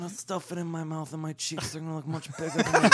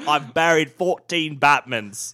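slow, loud character voice; hammed-up impression; 9 dB louder, slower than usual. Podcaster retrying the Batman line, slow hammed-up impression. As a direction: stretch each word out loudly, theatrically.

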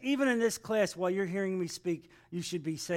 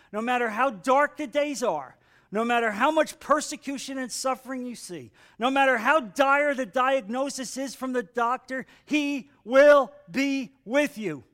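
building, louder pulpit delivery; emphatic preaching; 5 semitones higher, 7 dB louder than usual. Real, not acted. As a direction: build up, then stretch the last words.

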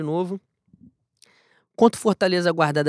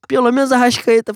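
louder, higher emphatic plea; 6 semitones higher, 8 dB louder than usual. Real, not acted. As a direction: punch it out, pleading loudly. Repeats the wish, second one louder.

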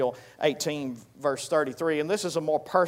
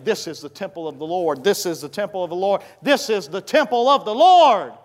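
each "this is the temple of the Lord" louder and higher; mounting emphasis; 4 semitones higher, 9 dB louder than usual. Acted. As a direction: repeat "temple of the Lord", building from usual voice to high shout. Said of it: "You put all the emphasis you want on it".

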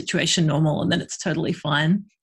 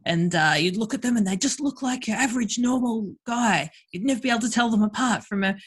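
bad Australian accent. Mimicking the sex-ed bloke in a bad Australian accent. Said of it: "here's my bad Australian accent".

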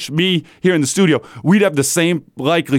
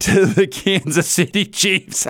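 ordinary talk

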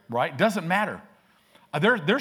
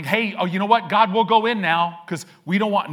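mock-eager Israelites, higher and louder; hammed-up eager crowd voice; 6 semitones higher, 7 dB louder than usual. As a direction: play the fickle crowd suddenly keen. Israelites voiced mocking, begging God.

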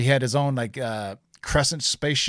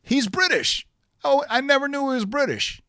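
surprised; 12 semitones higher than usual. Real, not acted. Amazed an actor is British.

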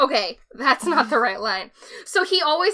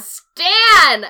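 mock yell; 4 semitones higher, 9 dB louder than usual. Echoing the call louder.

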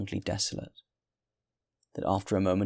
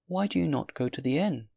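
put-on high, earnest old woman's question; concerned old woman; 8 semitones higher, 3 dB louder than usual. A narrator's reading. Good woman urges the man toward lodging, high and concerned. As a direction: ask it high, swinging up with concern.

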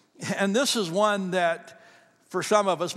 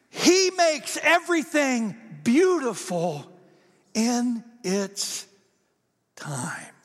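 slow tender climax; 6 semitones higher, much slower than usual. Real, not acted. Preacher's climactic closing line.

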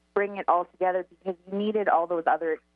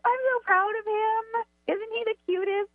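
much higher, slow gushing mom voice; doting mom; 13 semitones higher than usual. Retold or imitated. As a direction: coo over him like a proud mother. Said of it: "She's like".

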